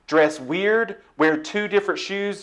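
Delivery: ordinary talk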